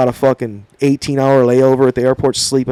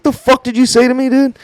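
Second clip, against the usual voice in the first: high-pitched confrontational comeback; retold indignant challenge; 11 semitones higher than usual. Trainee bursting through curtains at trainer, indignant. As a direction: act out popping up to challenge an insult.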